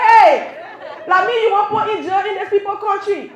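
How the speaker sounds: put-on loud, high outburst at a child; alarmed scolding. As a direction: cut the kid off in mock outrage. Mother cutting off son's accusation.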